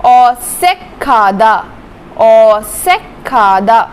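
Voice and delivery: औसेखादा slowly, s between vowels; slow pronunciation demo. Shown as a demonstration. Teacher drilling the word, slow. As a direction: draw out औसेखादा syllable by syllable. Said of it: "दो vowel के बीच में".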